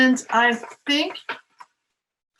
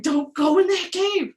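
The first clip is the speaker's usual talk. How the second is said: warning; 8 semitones higher, 3 dB louder than usual. Pretend. Warning the story birds.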